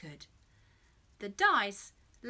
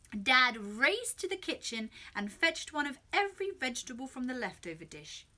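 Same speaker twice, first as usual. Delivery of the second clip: ordinary delivery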